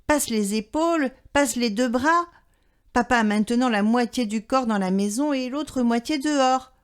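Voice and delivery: ordinary delivery, nothing marked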